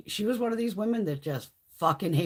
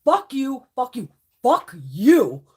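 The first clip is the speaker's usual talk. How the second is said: loud, punchy fuck yous at the coworker; loud retold quitting outburst; retold yell; 4 semitones higher, 10 dB louder, slower than usual. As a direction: bark them out as you quit. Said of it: "and I did".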